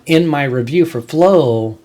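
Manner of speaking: ordinary talk, nothing marked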